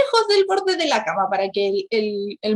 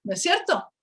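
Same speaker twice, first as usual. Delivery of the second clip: normal talking voice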